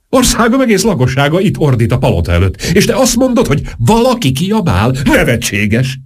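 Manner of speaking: high, scandalised outburst; indignant tirade. Acted. King scolds the duchess over the roaring crowd, high and indignant. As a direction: pitch it high in disbelief and scorn.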